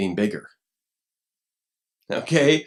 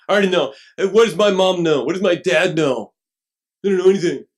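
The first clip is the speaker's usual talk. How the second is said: loud, higher, sneering know-it-all; arrogant scoffing; 5 semitones higher, 7 dB louder than usual. Acted. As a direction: scoff at mom and dad. Arrogant fool dismissing parents.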